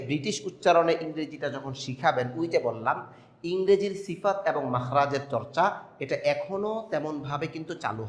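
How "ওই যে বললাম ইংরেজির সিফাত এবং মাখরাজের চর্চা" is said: ordinary talk, nothing marked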